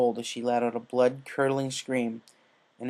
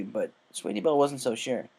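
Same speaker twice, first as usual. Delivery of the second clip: ordinary delivery, nothing marked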